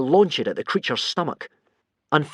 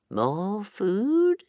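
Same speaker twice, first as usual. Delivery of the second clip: high, far-off creature voice; curious monster voice; musing creature; 9 semitones higher than usual. Acted. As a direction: wonder aloud like a hungry beast. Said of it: "it mused to itself".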